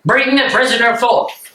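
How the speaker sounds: barked command. Acted. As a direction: call it down the corridor. Guard's order offstage.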